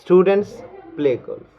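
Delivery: slow, every word spaced; slow drill. Shown as a demonstration. Teacher leading students in a slow repeat drill. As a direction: let them say it along with you. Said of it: "गौर से सुनना और मेरे साथ में बोलो".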